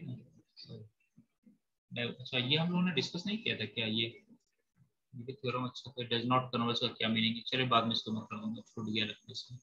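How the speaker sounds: low, soft aside, half to self; muttering to oneself. Real, not acted. Teacher checking what was skipped in class, muttering to himself. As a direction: think aloud quietly, voice dropped low.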